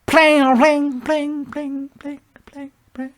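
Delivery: high plings fading away to nothing; imitated echo. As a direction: mimic a clink echoing through a big hotel. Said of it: "it makes an echo".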